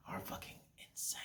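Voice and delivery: hushed whispered aside. Real, not acted. Ranting about women, then a hushed whisper. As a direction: drop to a whisper for the punchline.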